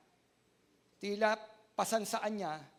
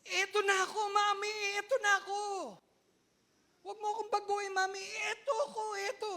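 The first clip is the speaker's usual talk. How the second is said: higher, insistent self-defense; defiant young self; 11 semitones higher, 5 dB louder, faster than usual. Retold or imitated. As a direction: plead to stay as you are, higher. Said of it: "Kasi pag nagsalita po ako".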